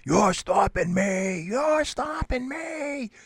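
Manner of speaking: slow, high imitated yelling; retold yelling. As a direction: shout it back as the angry driver did. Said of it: "he's yelling and screaming at me".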